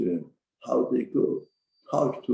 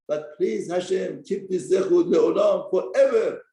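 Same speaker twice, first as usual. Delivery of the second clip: higher, slow pleading to God; pleading prayer; 6 semitones higher, 4 dB louder, slower than usual. Acted. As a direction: beg Hashem in a raised voice.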